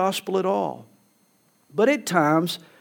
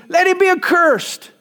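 raised, forceful pronouncement; emphatic curse; 10 semitones higher, 4 dB louder than usual. Retold or imitated. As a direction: let him be accursed, pitched higher and louder. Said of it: "Paul continues in verse eight".

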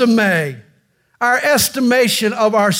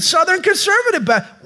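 1 excited; 11 semitones higher, faster than usual. Acted. Jumper answers in high voice.

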